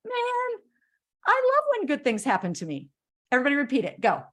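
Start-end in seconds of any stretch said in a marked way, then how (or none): 0.0-2.9 upbeat affirmation. Shown as a demonstration. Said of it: "go like this".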